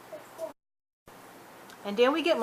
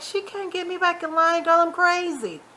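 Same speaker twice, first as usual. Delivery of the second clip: high-pitched mocking boast; imitating a brazen troublemaker; defiant; 8 semitones higher than usual. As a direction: brag defiantly, pitched way up, stretching the end. Acting out Melissa's defiant boast.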